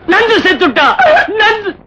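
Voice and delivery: high, wailing death announcement; put-on wail of bereavement; mock grief. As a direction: cry out the news in fake grief.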